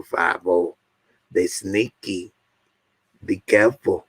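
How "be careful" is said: usual speaking voice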